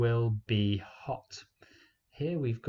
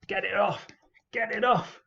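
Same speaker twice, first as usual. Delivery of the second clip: high frantic cry to get it off; panicked; 10 semitones higher than usual. Pretend. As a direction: yelp like a spider is on your face. Spider on face, panicked cry.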